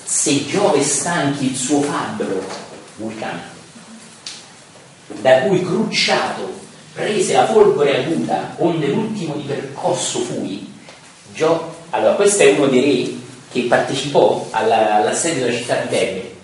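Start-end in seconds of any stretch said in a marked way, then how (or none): 0.0-10.7 slow, emphatic verse declamation; lettura di versi. Acted. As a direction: declaim each line with drawn-out words. Reads Dante's verses aloud. (declamazione lenta ed enfatica)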